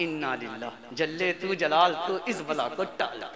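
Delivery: mock dread. Bride dreads mother-in-law.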